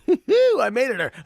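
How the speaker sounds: laughing, high, loud triumphant cry; excited. Retold or imitated. Celebrating a win, laughing and excited. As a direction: burst out with giddy joy at the win.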